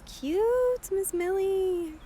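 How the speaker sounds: high, slow cooing to the dog. Real, not acted. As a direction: coo it sweetly to the puppy. Cooing over the dog's harness.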